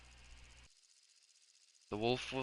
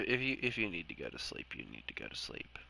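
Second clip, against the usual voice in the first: much softer and lower, gentle; 6 semitones lower, 12 dB quieter than usual. Real, not acted. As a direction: ease off to a near murmur. Telling Rat to sleep.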